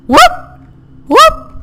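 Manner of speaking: high, loud jumping whoop; sudden whoop of effort. Real, not acted. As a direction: whoop it out on the jump. Jumping while climbing.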